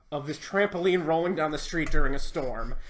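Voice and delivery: ordinary talk, nothing marked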